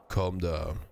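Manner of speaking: ordinary delivery, nothing marked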